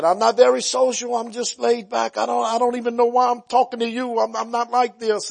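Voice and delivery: a hint of a shy young man opening up; mild imitation of a puzzled convert; retold young man. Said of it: "he said".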